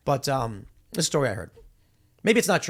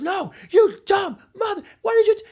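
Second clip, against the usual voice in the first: much higher, loud, hammed-up raging shriek; furious acted scream; 6 dB louder than usual. Enraged driver screaming at the man who hit him, shrill acted fury. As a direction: scream in the other man's face.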